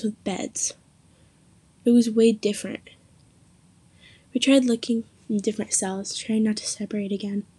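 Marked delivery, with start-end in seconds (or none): none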